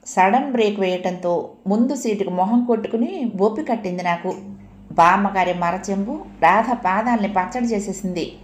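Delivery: usual speaking voice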